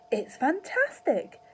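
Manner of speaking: high, loud and slow delighted shout; drawn-out thrilled cry; excited shout. A narrator's reading. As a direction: marvel out loud. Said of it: "Mister Cranky shouted".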